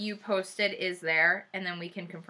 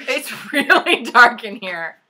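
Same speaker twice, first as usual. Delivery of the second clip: laughing; 2 semitones higher, 10 dB louder, slower than usual. Real, not acted. Laughing at how dark the room is.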